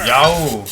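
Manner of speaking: loud, drawn-out whoop; triumphant cry. Acted. Player voices inventor completing his creation, triumphant cry. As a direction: let out an inventor's yell.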